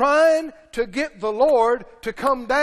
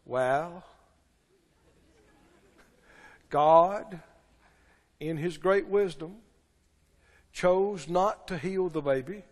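mock preacher consolation; 5 semitones lower than usual. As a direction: parody a pastor soothing his flock. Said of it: "the preachers were taught to tell us".

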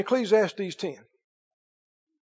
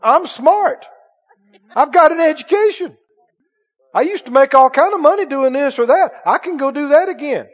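boastful; 5 semitones higher, 4 dB louder than usual. Retold or imitated. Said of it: "Have you ever heard that before?".

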